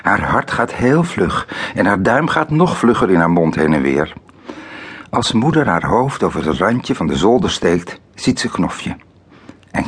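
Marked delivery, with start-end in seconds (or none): none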